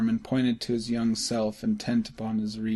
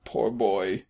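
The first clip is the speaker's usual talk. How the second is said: pity. A narrator's reading. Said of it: "he said in pity".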